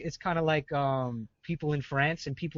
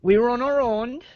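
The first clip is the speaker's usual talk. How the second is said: put-on Jamaican accent; 4 semitones higher than usual. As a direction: boast like a Jamaican gangster. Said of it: "describe that in Jamaican".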